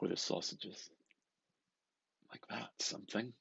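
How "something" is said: ordinary delivery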